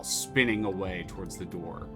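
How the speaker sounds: ordinary talk, nothing marked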